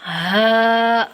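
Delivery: ہا with a full h, held long; drawn-out heavy ہا. Shown as a demonstration. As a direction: sustain ہا with a weighty breath of h. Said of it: "ہا کو heavy کر کے پڑھنا ہے".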